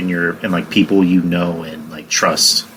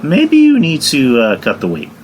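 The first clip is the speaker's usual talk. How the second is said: mock straight-up advice; 6 semitones higher than usual. Someone you know advising on weight, acted straight-up hint. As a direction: act out a close one's candid nudge. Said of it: "that tells you straight up".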